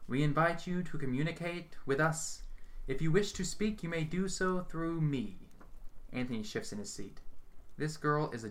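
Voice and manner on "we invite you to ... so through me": high, slow, solemn summoning; seance invocation. A narrator's reading. Medium invites spirits into the dim room, slow and solemn. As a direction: intone it like a ritual.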